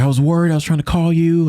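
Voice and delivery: anxious friend scolding him gently; worried girls. Retold or imitated. Girls voiced on his return.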